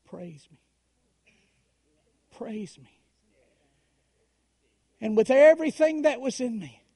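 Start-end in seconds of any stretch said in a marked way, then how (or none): 0.0-3.0 inner voice. Retold or imitated. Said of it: "I heard these words again".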